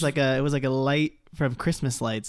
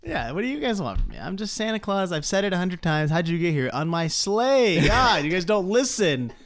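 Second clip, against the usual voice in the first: exasperated Santa; 3 semitones higher than usual. Acted. Fan acting Santa fed up with his captors, mock exasperation. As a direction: protest wearily, stretching the last words, then laugh.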